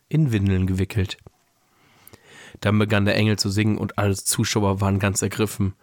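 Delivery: measured read-aloud storytelling voice; story narration. A narrator's reading. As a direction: read the Christmas story aloud, pausing between sentences. Reads a Christmas story aloud.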